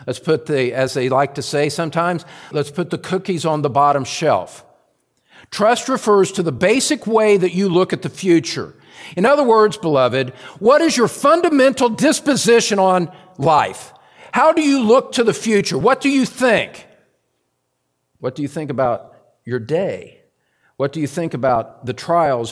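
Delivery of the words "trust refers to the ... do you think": high, forceful pulpit questioning; urgent, raised-pitch sermon fervor; impassioned preaching. Real, not acted. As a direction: press each question on the congregation.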